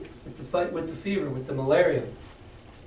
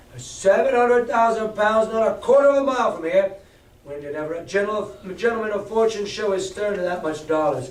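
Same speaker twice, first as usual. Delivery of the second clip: defiant pirate captain; 3 semitones higher than usual. Acted. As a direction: bluster to stiffen their nerve. Silver rallies the pirates.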